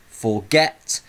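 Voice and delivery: FORGET going up on its last syllable, get; stress on GET. Shown as a demonstration. Teacher showing how the voice goes up, stress on get. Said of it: "'cause I go up".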